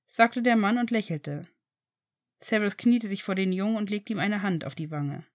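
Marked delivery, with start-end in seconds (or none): none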